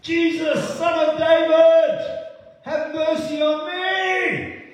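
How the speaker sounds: high, loud, slow cry for mercy; desperate shout. Retold or imitated. Blind Bartimaeus calling out to Jesus, a high slow desperate shout. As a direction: scream it across the crowd to reach Jesus. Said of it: "He screams his lungs out".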